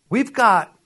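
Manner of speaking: ordinary talk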